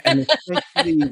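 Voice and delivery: breaking into a laugh mid-word; giggling through the word. Real, not acted. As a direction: laugh it out, pitch jumping up.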